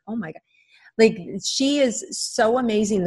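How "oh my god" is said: softly amazed, dropping well down in volume; quiet, awestruck reaction under the breath; retold hushed awe. Coach recalls hearing the girl's wind story, hushed awe.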